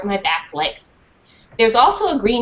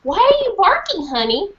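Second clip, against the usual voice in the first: sweet teacher; 10 semitones higher than usual. Acted. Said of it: "said a dark-haired teacher".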